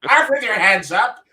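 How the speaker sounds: put-on loud, old-time cop calling out; 1930s policeman. 1930s cop calling to a gangster, loud and old-time. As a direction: bark it like a movie cop at a hideout. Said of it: "my nineteen thirties policeman".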